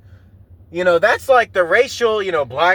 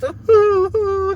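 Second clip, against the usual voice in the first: high crying hoo hoo, like a grieving ape; high mournful ape hoots with a cry; gorilla hoots; 12 semitones higher than usual. Retold or imitated. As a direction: mimic a sobbing gorilla, pitched way up. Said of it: "the gorilla's just".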